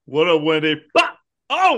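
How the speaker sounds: a high, mocking "What up, Wendy" then a burst; cartoonish mock villain greeting; mock Tinkerbell taunt. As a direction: taunt her like a little bully. Imitating Tinkerbell attacking Wendy.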